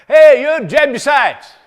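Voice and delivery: hollering. Acted. Young David hollering up at the Jebusites on the wall. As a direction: call up the hill like a boy shouting at an enemy wall. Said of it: "he's hollering".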